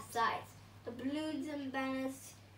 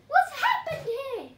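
hammed-up shock; shocked gasp; 13 semitones higher, 13 dB louder than usual. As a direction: gasp then a high, loud shocked question. Cucumber shocked at ruin.

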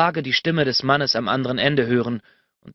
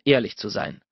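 ordinary talk, nothing marked

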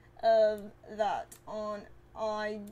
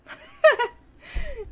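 very high, loud shriek of laughter; 11 dB louder than usual. Real, not acted. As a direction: let a loud squealing laugh burst out. Reacts to chat joke.

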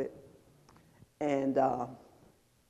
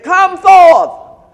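shouted command, much higher and louder; loud calling out; 11 semitones higher, 15 dB louder than usual. Retold or imitated. Preacher imitating Jesus calling to the dead in the cemetery, loud cry. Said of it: "in a loud voice".

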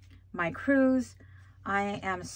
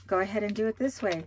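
usual speaking voice

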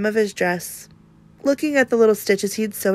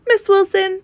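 lightly voiced proud little boy, higher; proud child voice; 11 semitones higher, 5 dB louder than usual. A narrator's reading. Jacob announcing proudly to his teacher. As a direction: chirp it out proudly like a small boy. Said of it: "Jacob said proudly".

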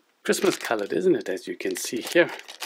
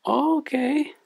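squeaky, high cartoon puppet sidekick; chirpy little puppet, much higher; 4 dB louder than usual. Puppet co-host Lani speaks.

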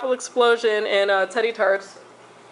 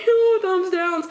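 mock whimper; 9 semitones higher, slower than usual. Jokes about dislikes after mock cry.

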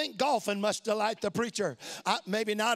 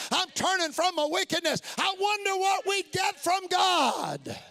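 full-force preaching; 5 semitones higher than usual. Acted. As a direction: preach it out to the back pews.

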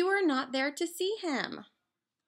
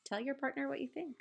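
ordinary talk

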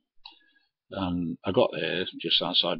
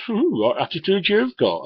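high, louder sneering ooh; mocking prissy tone; 12 semitones higher, 5 dB louder than usual. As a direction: sneer at his attitude. Bloke mocks the driver.

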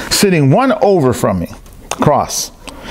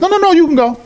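imitated high-pitched shooing away; hasty dismissal; 14 semitones higher, faster than usual. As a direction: wave them off urgently in a raised pitch. Retold hurried dismissal of interrupter.

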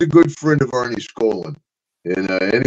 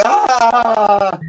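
high laughing yell; mock scream while laughing; 10 semitones higher, 4 dB louder than usual. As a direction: yelp as if bitten. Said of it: "he's going".

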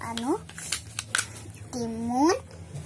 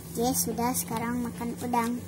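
normal talking voice

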